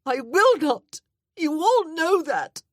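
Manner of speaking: high, loud, anguished outburst; sobbing protest; desperate cry. A narrator's reading. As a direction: cry out in defiance through tears. Distraught woman refuses love.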